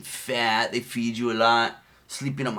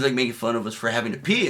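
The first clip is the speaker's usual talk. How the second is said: ordinary delivery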